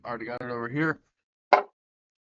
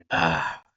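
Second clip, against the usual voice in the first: pained gasp; 9 semitones lower than usual. Real, not acted. First hot bite.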